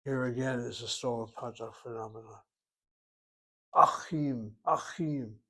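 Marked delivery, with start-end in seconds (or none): 3.8-5.3 ACHIM with a hard, loud throaty ch closing the first syllable; forceful guttural Het. Shown as a demonstration. Teacher showing how the Het closes the syllable, forceful guttural Achim. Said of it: "by the power of saying that Het".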